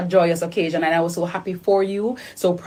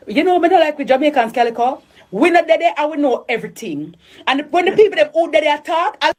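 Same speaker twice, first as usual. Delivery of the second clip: high, louder Jamaican Patois jeer; lightly mock the know-it-all Jamaicans; a hint of mocking Jamaican Patois; mock Jamaican gossip; 7 semitones higher, 3 dB louder than usual. Mimicking Jamaican gossip talk.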